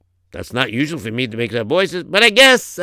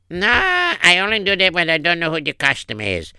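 higher voice, drawing out the conditions; officious secretary; 5 semitones higher, 4 dB louder than usual. Acted. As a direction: screen the visitor, slowing at the end. Acts the secretary screening visitor.

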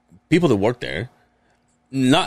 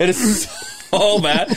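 laughing, drawn out; 8 semitones higher, slower than usual. Real, not acted. Laughing about smell.

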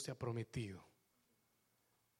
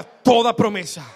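loud proclamation; 6 semitones higher, 16 dB louder than usual. Shown as a demonstration. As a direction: belt it out, much louder.

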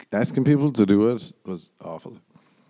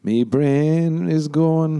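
high, slow, drawn-out wail; playful slow crooning whine; silly lament; 8 semitones higher, 3 dB louder, much slower than usual. Pretend. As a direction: stretch every word out high.

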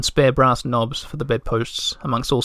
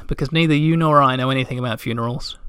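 usual speaking voice

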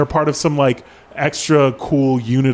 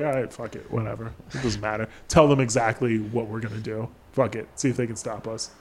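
much softer, low and defeated; muttered resignation; 2 semitones lower, 8 dB quieter than usual. Retold or imitated. Thief giving up on secrecy, quiet resigned mutter. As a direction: shrug it off under your breath. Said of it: "He's like".

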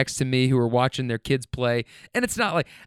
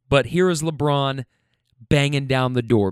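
ordinary talk, nothing marked

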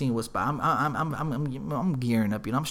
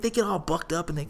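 usual speaking voice

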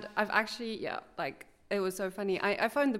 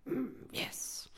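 grudging whisper; 4 semitones higher, 9 dB quieter than usual. Retold or imitated. Angry officer's grudging reply.

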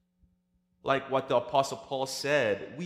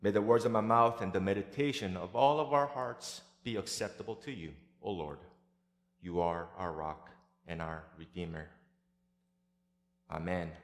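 prayer; 3 semitones lower than usual. Acted. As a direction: pray gently, leading the congregation. Leads the congregation in prayer.

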